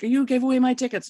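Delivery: a hammed-up angry customer at the box office. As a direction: imitate an upset theatregoer complaining. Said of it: "they were like".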